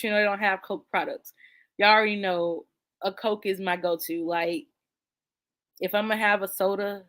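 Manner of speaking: normal talking voice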